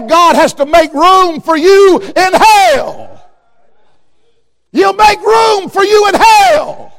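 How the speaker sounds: slow, high shouted warning; fiery hellfire preaching; preaching at full force. Real, not acted. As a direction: thunder the threat from the pulpit.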